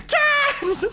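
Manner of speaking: very high shrieked word; mock shriek, pitch through the roof; imitated scream. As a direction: squeal it like terrified friends in the next room. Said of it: "I just hear screams".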